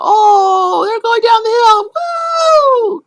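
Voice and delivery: put-on high, loud, whooping boy; boy's wild excited whoop; excited kid yell. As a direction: shout it like a boy racing cars downhill. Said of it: "if they're talking about".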